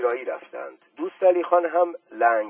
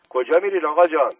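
anxious call; 4 semitones higher, 9 dB louder, faster than usual. Acted. Boy calling after leaving uncle.

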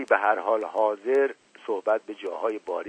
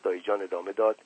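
ordinary delivery, nothing marked

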